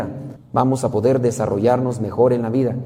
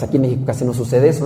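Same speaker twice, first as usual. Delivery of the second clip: usual speaking voice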